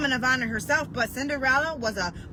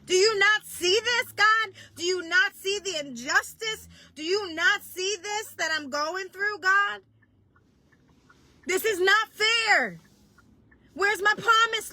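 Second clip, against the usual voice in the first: yelling at God; 9 semitones higher than usual. Retold or imitated. Preacher reenacting yelling at God, high desperate cry. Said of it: "I yelled to God at the same time".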